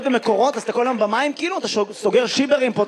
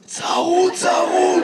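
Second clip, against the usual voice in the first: higher, strained mock-hoarse voice; 5 semitones higher, much slower than usual. Comedian echoes hoarse audience man, hoarse. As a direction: imitate someone who lost their voice. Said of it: "ממה אתה צרוד".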